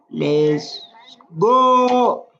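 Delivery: a big rally cry to start; excited cheer. Real, not acted. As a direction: fire up the viewers. Hyping start of the video.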